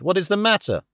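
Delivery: cried question. A narrator's reading. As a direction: cry out in dismay, pitch leaping up. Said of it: "I cried".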